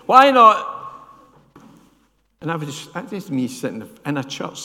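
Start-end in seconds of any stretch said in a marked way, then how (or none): 0.1-0.7 preacher's shout. Retold or imitated. Preacher challenging from the pulpit.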